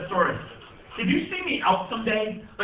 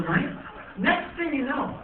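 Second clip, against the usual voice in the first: usual speaking voice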